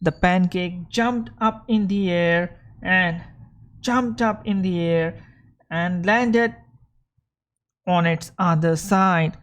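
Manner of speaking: reading a fairy tale aloud to the class; lively storybook read for learners; story reading. Acted. Teacher reading a story to students, lively storybook voice.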